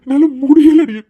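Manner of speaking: crying out, high and loud; husband breaking into loud tears. Acted. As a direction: sob it out, at the end of his rope.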